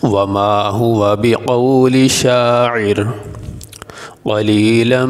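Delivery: slow melodic tajweed recitation; Quranic recitation. Shown as a demonstration. Teacher recites the verse for the students, slow and melodic. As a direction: chant the verse slowly, drawing out each syllable.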